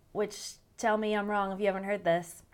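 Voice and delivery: ordinary talk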